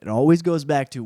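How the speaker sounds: usual speaking voice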